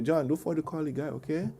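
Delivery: softly voiced gentle wife; calm, sweet reminder. Wife reminds husband to call the repairman, softly imitated. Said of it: "But the way she do it".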